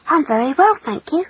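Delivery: high, shy politeness; high boyish courtesy; happy boy. Acted. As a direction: answer in a small, pleased voice. Happy boy replies shyly.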